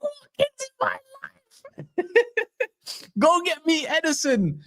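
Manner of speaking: high, giggling mock fantasy; laughing. Real, not acted. Fan joking to chat about Saudi riches, laughing. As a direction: laugh through the words, way up high.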